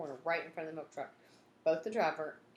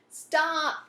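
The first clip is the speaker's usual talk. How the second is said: high, loud yell; 12 semitones higher, 11 dB louder than usual. Acted. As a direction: bellow it after the fleeing kids. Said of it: "he yelled".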